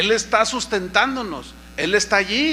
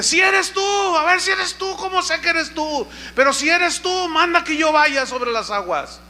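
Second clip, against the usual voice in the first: doubtful shout; 8 semitones higher than usual. Retold or imitated. Pedro shouting from the boat.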